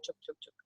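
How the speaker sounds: whispered chug chug; chugging noise. Retold or imitated. To her listeners, miming the coaster climb, whispered chugs.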